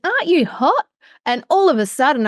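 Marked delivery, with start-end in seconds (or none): 0.1-0.9 mum asking, pitched much higher; a fussing mum checking on her child; mum's concerned question. Retold or imitated. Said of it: "And she goes".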